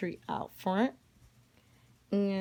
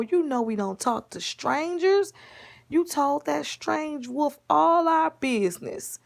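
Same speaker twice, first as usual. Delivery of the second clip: put-on high-pitched granny telling a child off; exasperated high grandma lecture; scolding grandma; 6 semitones higher, 4 dB louder than usual. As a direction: scold with long, emphatic stressed words. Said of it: "And the grandma said".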